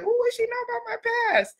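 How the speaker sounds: high, quick, soft squeal; mock indignant people. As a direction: imitate a nosy skeptic in a high little voice. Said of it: "Some people out there be like".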